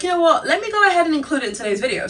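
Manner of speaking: high, quick eager voice; excited. Retold or imitated. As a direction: blurt it out eagerly. Excited retold thought.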